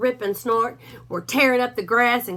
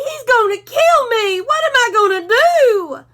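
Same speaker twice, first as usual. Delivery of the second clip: much higher, louder, crying panic; a terrified little dog yelping for help; panicked Drover; 13 semitones higher, 9 dB louder than usual. A narrator's reading.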